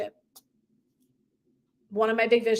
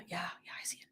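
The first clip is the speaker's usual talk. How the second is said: breathless frightened whisper; a scared client whispering back to her coach, timid whisper; 6 semitones lower, 20 dB quieter, faster than usual. Acted. Whisper it, voiceless and afraid.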